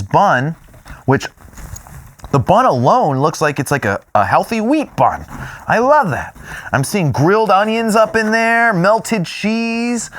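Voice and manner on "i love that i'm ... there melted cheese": high, excited gushing. Real, not acted. Cutting open the burger for viewers, high excited gushing. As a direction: gush over the burger, pitch way up. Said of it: "I'm getting excited".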